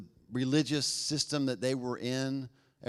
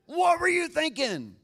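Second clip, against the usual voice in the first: much higher, louder, slow exasperated demand; anger and fear; 7 dB louder than usual. Shown as a demonstration. Parent scolding their kids, loud angry demand. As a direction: blast it at a kid who messed up. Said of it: "anger and fear-filled".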